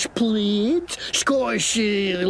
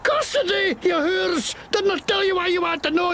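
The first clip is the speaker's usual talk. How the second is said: angry; 9 semitones higher than usual. Acted. Insulting the lawmen.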